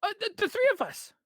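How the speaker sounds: high, loud stammering creature voice; stuttering high Kenku greeting; nervous bartender voice. Acted. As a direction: squeak it out like a jittery bird-man.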